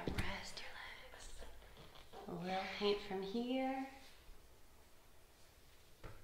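soft whispery murmur with long pauses; gentle, barely-there voice; hushed, soothing. Real, not acted. Quietly tending the next child.

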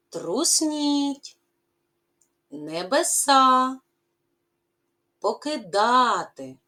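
"Тремтів, навздогін, потрушу, щасливий, трусніть, небеса, покидати" each said slowly and separately, stressed syllable hit clearly; correct stress. Shown as a demonstration. Teacher modelling word stress for pupils, slow and clear. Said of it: "правильно поставте наголос".

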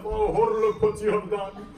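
loud, high lament; crying out in remorse. Retold or imitated. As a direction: cry out in self-reproach like a grieving preacher. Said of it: "He cries to himself".

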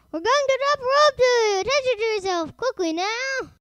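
high, loud rushed-sounding orders; urgent shout. A narrator's reading. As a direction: shout down urgently to someone in danger. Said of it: "shouted urgently to them".

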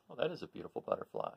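ordinary delivery, nothing marked

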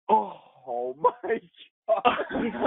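high laughing oh my God; imitating the crew's shocked laugh; laughing gasp. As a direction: break into laughter imitating the crew. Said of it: "everybody just looked up and was like".

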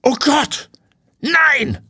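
high, loud horrified outburst; sudden horror; shocked cry. Acted. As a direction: gasp it out at the sight. Owner sees drained pond.

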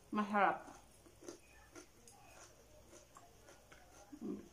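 talking between bites; chewing while talking. Real, not acted. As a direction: mouth partly full of food. Seen 2: Chews fried tofu pieces between phrases; mouth partly full while speaking.

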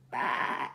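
a low bleating baa; sheep bleat. Acted. Sheep answering Duck with a low bleat. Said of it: "said Sheep".

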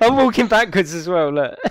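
barely holding in the laughter, loud; laughing. Real, not acted. Player showing friends his backwards walk, laughing.

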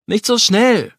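loud, high call to halt. Acted. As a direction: sharply stop someone leaving. Stops friend who is leaving.

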